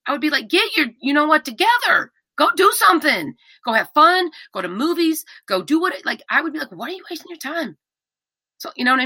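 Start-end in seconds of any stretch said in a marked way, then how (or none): 0.5-5.9 high-pitched, punchy pep-talk scolding; acted higher-pitched nagging encouragement; exasperated urging. Imagines scolding partner to go live. 6.7-7.8 higher, incredulous mock reproach; acted exasperated question; exasperated scolding. Imagines scolding partner to go live.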